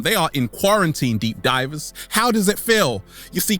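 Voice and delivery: ordinary talk, nothing marked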